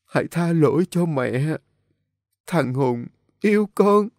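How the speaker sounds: high, broken, tearful phrases; halting dying woman. A narrator's reading. Dying mother begs forgiveness, halting and feeble. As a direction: gasp out the apology bit by bit. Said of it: "nói từng câu từng tiếng đứt quãng".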